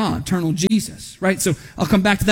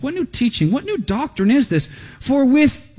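raised pitch, wondering as you read; mild amazement; 6 semitones higher than usual. A narrator's reading. People reacting to new teaching, mildly amazed. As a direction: read the crowd's question with some amazement. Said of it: "they all were amazed".

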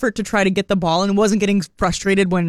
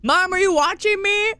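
put-on much higher little boy plea; eager kid voice; 11 semitones higher than usual. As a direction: repeat the kid's question, higher and pleading. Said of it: "Legit, legit".

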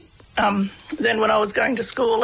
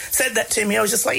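usual speaking voice

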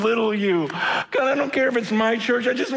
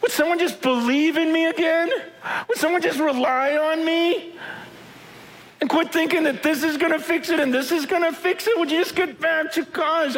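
God pleading; 6 semitones higher than usual. Acted. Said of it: "He goes".

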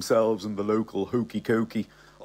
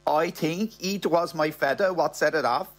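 higher, put-on Spanish-English accent; half Spanish, half English accent; 6 semitones higher than usual. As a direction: lay a mock Spanish accent over English. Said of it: "in a half Spanish, half English accent".

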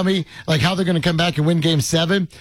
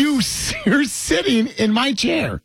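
high, drawn-out protest; 7 semitones higher, slower than usual. Real, not acted. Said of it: "He sounds like an old man".

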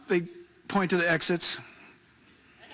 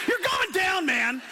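laughing punchline; 10 semitones higher, slower than usual. Acted.

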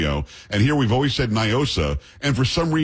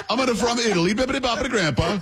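hammed-up, very high cartoon Italian; sing-song parody of an Italian accent; mock Italian accent; 11 semitones higher than usual. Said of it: "Can you imagine she came up?".

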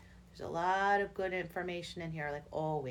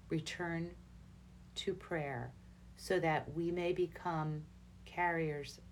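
slow, measured reading with spaced pauses; nice and slow. Real, not acted. Host reads Our Lady's message to listeners, nice and slow. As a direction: read it slowly, phrase by phrase. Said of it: "So I'll say it nice and slow".

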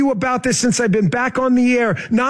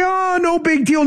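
high-pitched, drawn "Oh" in mock unconcern; mock dismissal; 8 semitones higher than usual. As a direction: imitate someone brushing off the "big deal". Mocks those who shrug it off.

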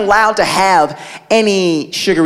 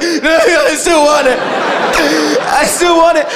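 high, hammed-up kid whimper; mock tearful craving; 7 semitones higher, slower than usual. Brother giving in to the cereal, high mock-tearful whine. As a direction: whine helplessly like a little addict.

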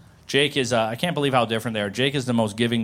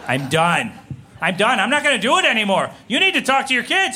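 building from firm to high, loud yelling; imitated wife losing it; escalating meltdown; 11 semitones higher, 6 dB louder than usual. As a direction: climb steadily into a fed-up rant.

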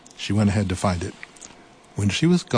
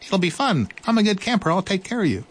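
high, bright little mouse voice; eager little mouse; 11 semitones higher, faster than usual. Acted. Mouse's voice, read aloud.

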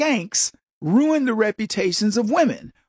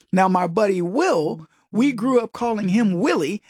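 ordinary delivery

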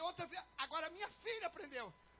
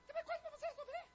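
high, squeaky mimicry of bickering; mock nagging exchange. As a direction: imitate a nagging back-and-forth in high pitch. Mimics wife's nagging and reply.

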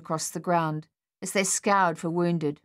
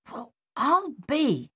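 slow, higher amazed mutter; 8 semitones higher, slower than usual. A narrator's reading. Captain watching the enemy meet, muttering amazed. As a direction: breathe it out in disbelief. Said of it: "Captain Egan muttered".

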